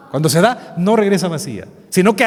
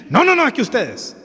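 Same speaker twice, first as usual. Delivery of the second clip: mock defensive churchgoer, pitched higher; a quick, prickly "no, no, no" from a person who feels attacked; defensive protest; 8 semitones higher than usual. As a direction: imitate someone bristling and cutting you off. People defending their own church.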